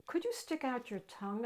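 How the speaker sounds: whisper. Acted. Girl nervously asking monster, soft whisper. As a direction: ask it in a nervous hush. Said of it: "I whispered".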